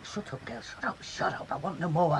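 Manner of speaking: whispered, begging them to stop; urgent hushed plea. Acted. Mother hushing husband and daughter in a whispered plea. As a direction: hiss it low to end the fight.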